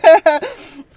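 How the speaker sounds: loud laugh. Real, not acted. Host showing she loves to laugh, loud. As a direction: laugh freely.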